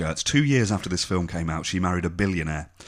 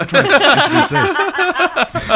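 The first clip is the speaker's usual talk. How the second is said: high, slow, laughing protest; 9 dB louder, much slower than usual. Real, not acted. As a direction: crack up mid-sentence. Laughing while scolding co-hosts.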